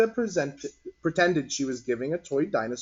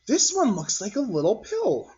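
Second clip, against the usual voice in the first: put-on higher, childlike musing; a small boy curious about a rock; child voice; 8 semitones higher than usual. A narrator's reading.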